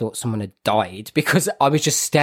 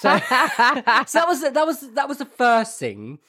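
high, giggling through the words; laughing; 11 semitones higher, slower than usual. Real, not acted. Laughing while telling the co-host about the call. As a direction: laugh as you say it.

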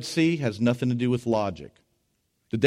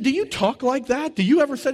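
high-pitched, incredulous retold question; astonished questioning; 11 semitones higher than usual. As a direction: press the question, high and amazed.

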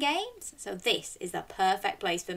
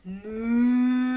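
mooing like a cow; 4 semitones higher, 4 dB louder than usual. Shown as a demonstration. Host shows children how a cow moos, playful. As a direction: moo for the children. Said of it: "Can you moo like a cow?".

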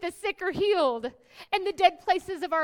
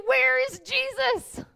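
higher, louder pleading call; desperate cry; 10 semitones higher, 5 dB louder, slower than usual. Acted. Preacher voicing believers in a storm, desperately calling out. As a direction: cry out as if lost.

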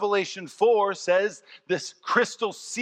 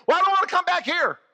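high, fast, loud grumble; hammed-up Lazarus griping; whining complaint; 13 semitones higher, 5 dB louder, faster than usual. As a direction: refuse to come back like a sulky Lazarus.

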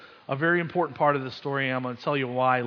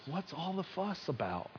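much softer, gently asking; barely above a hush, lightly voiced; soft voice of God; 3 semitones higher, 10 dB quieter than usual. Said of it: "And he's asking us".

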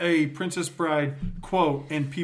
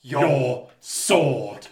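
slow menacing order; 7 dB louder, much slower than usual. Acted. Friend acting the duel line.

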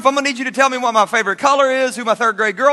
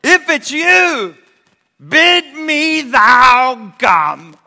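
slow solemn plea; 5 semitones higher, 8 dB louder, much slower than usual. Acted. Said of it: "He says".

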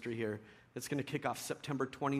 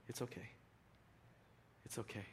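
much softer, gentle soothing; soft reassurance; 17 dB quieter, faster than usual. Retold or imitated. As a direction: hush it down like calming a child. Repeating speaker's soothing words.

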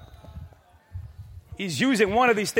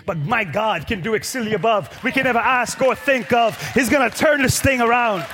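rising preacher's cry; 3 semitones higher, 4 dB louder than usual. Acted. Preacher promising the congregation God will turn it around, loud. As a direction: swell it up and shout it out.

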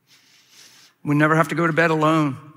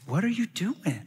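soft, higher voice imitating a woman; imitated wife, softly stopping him; hushed serious question; 4 semitones higher, 9 dB quieter than usual. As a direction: ask it quietly, in earnest disbelief. She asks again, now seriously.

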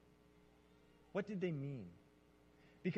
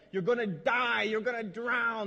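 mock doom; 5 semitones higher, 8 dB louder, slower than usual. Mock storm drama to congregation.

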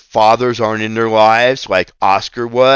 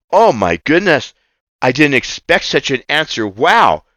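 normal talking voice

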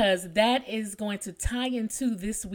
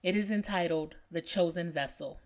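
ordinary talk

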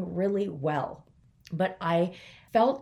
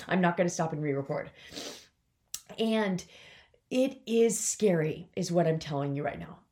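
usual speaking voice

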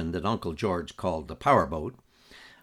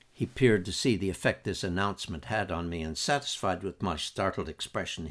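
usual speaking voice

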